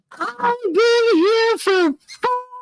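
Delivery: very high, drawn-out squeal; slow, squeaky, near hysterical; laughing retold complaint. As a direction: squeal the quote through helpless laughter. Helpless laughter retelling a friend's complaint.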